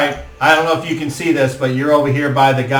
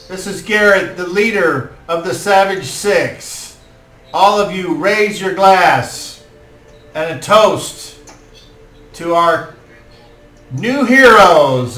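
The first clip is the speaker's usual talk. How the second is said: high, slow, rousing toast; lord's toast; 6 semitones higher, 5 dB louder than usual. Acted. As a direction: raise the hall to toast the heroes. Lord toasts the heroes.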